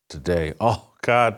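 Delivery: ordinary talk, nothing marked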